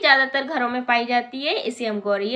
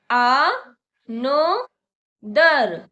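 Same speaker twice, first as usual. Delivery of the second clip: another stretched very slowly and louder, syllable by syllable; slow demo; 5 dB louder, much slower than usual. Shown as a demonstration. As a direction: pull another out slowly for the class. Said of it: "मेरे साथ बोलिए". (another को बहुत धीरे खींचकर)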